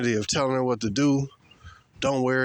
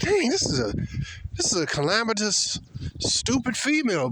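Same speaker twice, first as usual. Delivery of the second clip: disbelief; 6 semitones higher than usual. Retold or imitated. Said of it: "I'm like".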